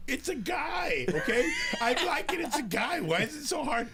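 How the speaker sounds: very high, loud mock yelling; exasperated kid protesting. Kid defending his drawing to arguing parents, hammed-up exasperated yelling. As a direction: plead at the top of your voice like a frustrated child.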